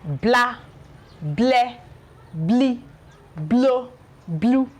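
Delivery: blah bleh blee blow blue, tongue lifted for a clear L on each; teacher modelling the L sound; L blend demo. Shown as a demonstration. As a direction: model each bl syllable with pauses. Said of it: "Watch how I pronounce this".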